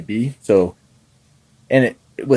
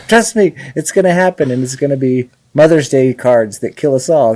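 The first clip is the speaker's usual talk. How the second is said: high, urgent doom warning; insane-sounding insistence; 4 semitones higher, 4 dB louder than usual. Retold or imitated. Guest warning a doubting family about killer cards, insistent. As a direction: plead to be believed, pitched up. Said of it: "my family thinks I'm insane when I'm like".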